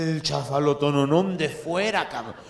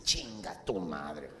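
whispered, slow hiss of an insult; a seated woman mutters a whispered insult; 11 semitones lower, 6 dB quieter, much slower than usual. Retold or imitated. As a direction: breathe the curse under your breath. Woman insults him quietly.

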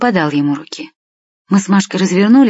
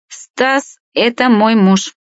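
slow, higher-pitched drawn-out claim on a husband; said through the teeth; 6 semitones higher, slower than usual. A narrator's reading. Second woman claims Stas as her husband, drawn out through the teeth. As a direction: draw it out with disdain, pitched up. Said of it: "процедила вторая тётка".

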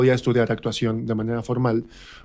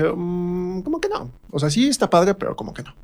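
unconvinced; 7 semitones higher than usual. Retold or imitated. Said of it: "vi una de sus obras y dije".

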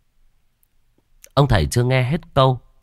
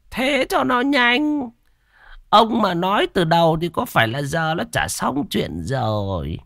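high, drawn-out put-on master's voice, mollified; high-pitched busybody exorcist; pompous shaman; 9 semitones higher than usual. A narrator's reading. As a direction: take charge in a high, self-important voice.

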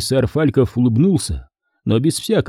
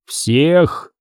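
mechanical helmet growl; 3 semitones higher than usual. Acted. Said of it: "механический рык".

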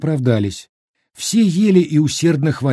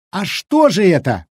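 raised, menacing demand; angry pressing; 6 semitones higher, 3 dB louder than usual. A narrator's reading. Village woman pressing the cook, full of spite. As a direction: bear down on him with the question. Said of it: "наседала Аниська Донецкова, страшная в своей злобе".

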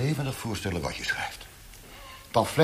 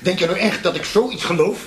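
much higher and louder, scoffing; loud incredulous ridicule with a laugh; mocking shout; 9 semitones higher, 6 dB louder than usual. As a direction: jeer at his naivety at full voice. Interrogator mocks the prisoner's story.

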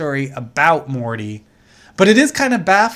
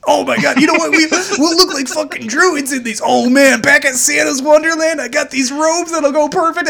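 high-pitched mock enthusiasm; hammed-up drunk thrilled by his own plan; excited drunk; 10 semitones higher, 4 dB louder than usual. Acting out excited drunk brothers.

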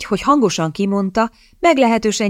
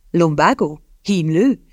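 grinning guesses; slower than usual. A narrator's reading. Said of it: "találgatott vigyorogva".

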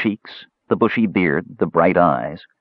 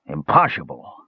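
tipsy dwarf, low falling voice; ale-dulled call; 4 semitones lower than usual. A narrator's reading. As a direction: call it out as a drunk, pitched down. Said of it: "a familiar voice that the half-elf suspected was dulled by ale".

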